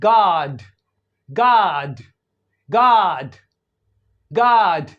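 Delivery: गॉड as "gaad", long open unrounded AH; American open O. Shown as a demonstration. Teacher demonstrating American गॉड to students, long open AH. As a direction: open the vowel of गॉड like the first vowel of father. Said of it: "इस तरह से पढ़ा जा रहा है".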